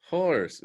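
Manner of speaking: "Horse" with the f sounding as h, breathy start; "Horse" for force. Retold or imitated. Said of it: "He went".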